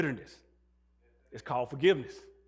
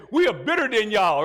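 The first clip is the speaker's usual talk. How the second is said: loud boast; 4 semitones higher, 11 dB louder than usual. Retold or imitated. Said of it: "nobody say".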